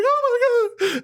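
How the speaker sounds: very high, slow mock gasp; hammed-up shocked squeal; shocked girlfriend. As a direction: stretch it out like a stunned girl.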